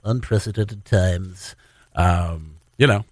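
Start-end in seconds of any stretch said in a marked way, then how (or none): 0.0-1.5 low, slow mock-grave voice; weighty, drawn-out crisis-ad delivery; solemn announcer voice. Radio host on pandemic clichés, mock solemn. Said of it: "say it with that voice".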